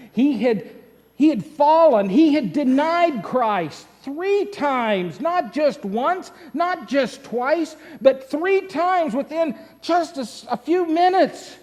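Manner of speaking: high, loud, impassioned preaching; preacher hammering on three denials, loud and high; full-force preaching. Acted.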